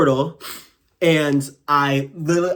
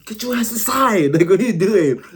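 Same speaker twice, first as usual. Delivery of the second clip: imitating a higher, exasperated yell at her aunt with a laugh; mock scolding; 7 semitones higher, 3 dB louder than usual. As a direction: playfully tell her off through the door. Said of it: "be like".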